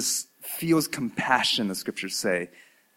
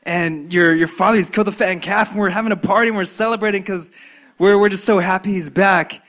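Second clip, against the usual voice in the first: higher, loud, fast excited babble; excited servant; 7 semitones higher, 6 dB louder than usual. Acted. Servant telling the elder brother about the party, excited and high. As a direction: gush the news, high and quick. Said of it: "And the servant says".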